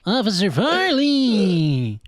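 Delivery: high, loud, long-drawn mock call; calling out in character. Host interrupts film talk to call the officer, drawn-out call. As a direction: stretch the name out high and loud.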